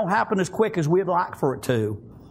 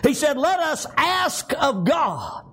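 fervent preaching; 7 semitones higher than usual. Real, not acted. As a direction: preach it with conviction to the congregation. Preaching with force.

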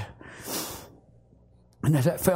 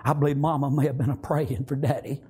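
normal talking voice

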